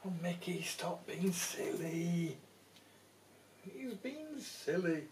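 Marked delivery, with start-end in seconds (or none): none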